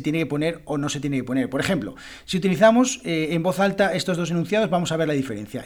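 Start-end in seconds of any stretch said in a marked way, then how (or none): none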